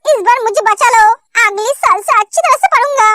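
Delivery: ordinary delivery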